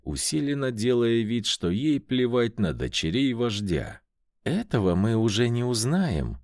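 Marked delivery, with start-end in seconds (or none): none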